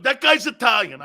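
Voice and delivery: high, loud retold exclamation; excited grandfather. Grandfather watching Franco play, excited. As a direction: proclaim it like a proud fan.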